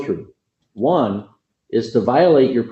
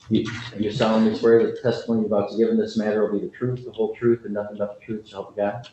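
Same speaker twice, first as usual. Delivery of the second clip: rote court oath; 7 dB quieter, faster than usual. Real, not acted. Judge swearing in a witness.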